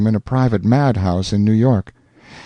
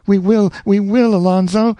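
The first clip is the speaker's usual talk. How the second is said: high, rapturous heroine; cling to him with joy; Rosanna, rapturous; 10 semitones higher than usual. A narrator's reading. Rosanna begging Alonzo to stay, rapturous.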